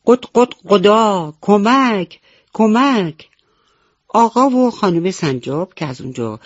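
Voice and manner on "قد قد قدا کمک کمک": high, loud cry for help as mother hen; calling for help. Acted. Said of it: "مامان مرغ دوباره گفت". (با صدای بلند و زیر فریاد کمک)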